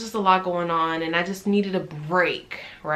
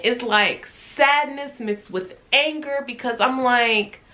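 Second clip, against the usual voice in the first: ordinary talk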